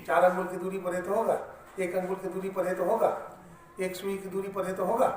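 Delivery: preaching with rising force, step by step; emphatic preaching. Acted. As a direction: build the argument with forceful repetition. Preacher driving the point louder.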